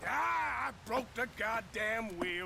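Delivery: loud, exasperated outburst; angry yell over a busted wheel; frustrated shout. Acted. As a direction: bark it out at the broken wheel. Wagon wheel breaks.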